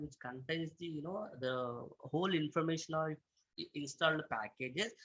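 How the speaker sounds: very soft, hard to hear; speaking too quietly; barely audible. Real, not acted. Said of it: "your voice is very low".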